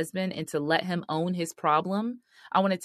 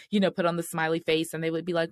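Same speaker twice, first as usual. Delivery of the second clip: ordinary delivery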